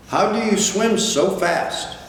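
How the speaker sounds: small whale calling up high; child voice. Acted. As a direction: call out like an eager little one. Child whale asks father.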